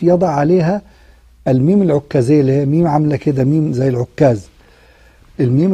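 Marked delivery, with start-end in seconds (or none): none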